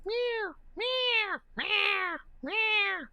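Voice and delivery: very high drawn-out meows; high-pitched imitated cat cries; kitty meows. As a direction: mew like a kitten. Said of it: "makes kitty sounds".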